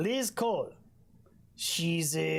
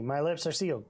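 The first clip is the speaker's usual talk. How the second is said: ordinary talk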